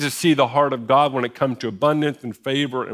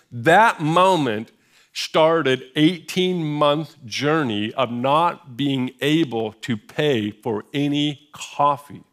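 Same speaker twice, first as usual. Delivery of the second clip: drawn out, each word weighed; slow it right down for weight; deliberate preacher's slowdown; slow emphasis; much slower than usual. Real, not acted. Slow dramatic emphasis on the story.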